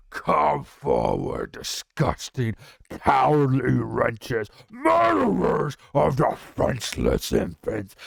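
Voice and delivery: loud, slow monstrous dragon voice with a shout; a raging dragon taunting his prey; furious dragon threat. Acted. Deathwing threatens the heroes, furious and loud. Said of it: "his voice like fire".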